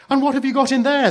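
put-on very high, loud, fast child's question; eager. A narrator's reading. Children ask about the box, eager. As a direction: pipe up with eager curiosity. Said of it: "they asked eagerly".

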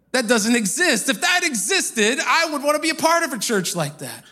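high-pitched, emphatic retold disbelief; incredulous diner owner. Diner owner answering the preacher's claim about church, incredulous.

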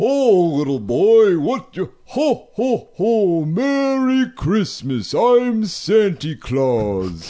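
slow, booming Santa voice; jolly drawn-out ho ho ho Santa; mall santa. Pretend. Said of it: "Do your fucking Santa Claus".

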